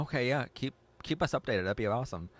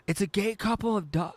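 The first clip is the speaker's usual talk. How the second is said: high, loud gasp of surprise as it hits; 13 semitones higher, 6 dB louder than usual. Real, not acted. Host gasps at a viewer's bird picture, surprised. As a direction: blurt it out shocked and delighted. Gasping discovery of two birds.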